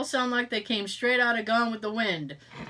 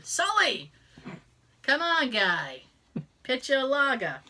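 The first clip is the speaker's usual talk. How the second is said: mock Southie accent. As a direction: call a pal like a movie Southie. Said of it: "sounds like they're from Southie".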